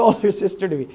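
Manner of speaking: usual speaking voice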